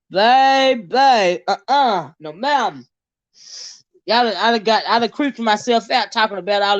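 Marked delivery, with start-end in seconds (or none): none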